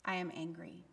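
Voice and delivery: low, very soft, restrained statement; softly angry. Shown as a demonstration. Preacher showing congregation a gentle anger, quiet and low. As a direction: say it small and contained, almost under your breath. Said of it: "Does it help if I say".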